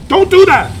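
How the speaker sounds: high, loud scolding; mock angry parent. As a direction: snap at the kid, loud and high. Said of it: "take it".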